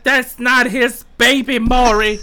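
slow, high, loud daytime-TV guest accusation; talk show guest. Retold or imitated. A girl in a clip yelling at Maury, slow, high and loud. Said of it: "She's like".

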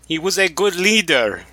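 high, hammed-up Russian accent. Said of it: "like, stereotypical Russian".

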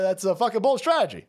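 normal talking voice

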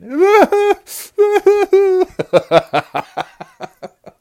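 loud high cackling laugh; big gleeful laugh. Real, not acted. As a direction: burst into a long triumphant cackle. Gloating about kid crying.

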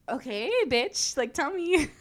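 drawn-out, high-pitched sassy mock demand; hammed-up demand. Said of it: "That's not really what I said".

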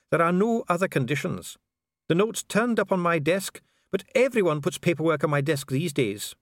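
precise official Dee. Acted. Official explains the mechanism to visitor, formal.

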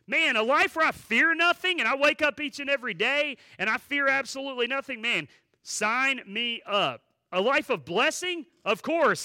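loud, high, eager outburst; eager congregation member. Acted. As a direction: jump at the offer, full voice. Voices eager congregation signing up.

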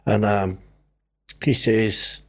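ordinary talk, nothing marked

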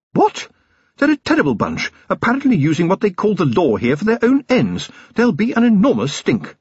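very high-pitched, indignant lady; scandalised wife. Acted. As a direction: protest the plan in alarm. Sybil alarmed at husband's plan.

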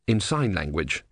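plain reading voice

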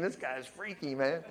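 hushed, sceptical aside under the breath; muttered thought. Retold or imitated. Listeners privately doubting the preacher, muttered softly. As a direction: drop it very soft, like a private thought.

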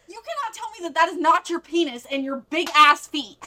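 high, loud replayed outrage; indignant retold confrontation. Girlfriend rejecting boyfriend's denial of the tape, indignant.

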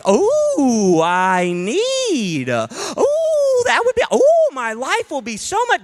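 very high, breaking gasping want; mock swooning desire. Preacher mocks shoppers swooning over their wish list, very high. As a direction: gush like you'd die without it. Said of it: "but we're like".

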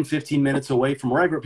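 ordinary delivery

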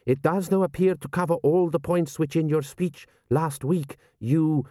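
measured general, pitch held up. A narrator's reading. General examining the document for his prince, measured and formal. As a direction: weigh each clause as a formal military man.

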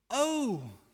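Oh drawn out high and loud, full of longing. Shown as a demonstration. Preacher showing the congregation a longing Oh. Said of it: "but the first word is".

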